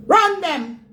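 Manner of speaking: high, loud scornful yell; scornful shout. Real, not acted. Ranting at internet critics, a loud scornful shout. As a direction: spit the word out in contempt.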